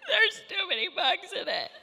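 high, loud, slow mock wail; a child's hammed-up crying complaint; imitating a crying child. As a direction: play the sobbing seven-year-old. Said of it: "my seven-year-old is crying".

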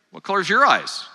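ordinary talk, nothing marked

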